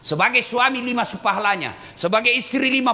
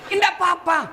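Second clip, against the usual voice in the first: shouting; 7 semitones higher than usual. Real, not acted. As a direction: call it out to the husbands. Preacher shouting at husbands.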